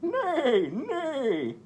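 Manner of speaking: high squealing neigh; whinny it out like a horse. Acted. Voicing the horse for the children, high whinny. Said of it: "said the horse".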